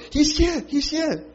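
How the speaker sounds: high, breathless excitement; excited announcing. Retold or imitated. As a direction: tell everyone, thrilled. Rhoda announcing Peter excitedly.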